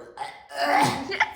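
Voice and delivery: high burst of laughter. Real, not acted. Laughs at funny story.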